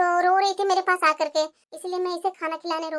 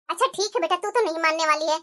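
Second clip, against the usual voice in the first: normal talking voice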